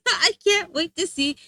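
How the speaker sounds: ordinary talk, nothing marked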